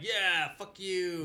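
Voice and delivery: high, loud retort; mock defiant crowd. The group backing the vampire chick, mock defiance. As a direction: throw it back high and loud, imitating a group. Voicing the group turning on him.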